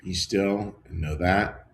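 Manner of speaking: slow, low meditative voice with long pauses; slow contemplative prayer reading; guided meditation. Acted. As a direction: let each shortened line settle into silence. Leads a meditation.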